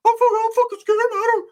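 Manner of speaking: way up high, squeaky and scared; mock him in a tiny high voice; pinched high mock of a scared homeboy; high squeaky Rick voice. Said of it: "He doesn't talk like this anymore".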